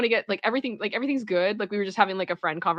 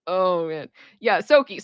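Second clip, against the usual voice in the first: ordinary delivery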